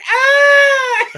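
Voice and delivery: loud high mock scream of pain; imitated pained scream. Retelling the firework victim, mock scream loud and high. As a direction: belt out his scream as a joke. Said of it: "he said".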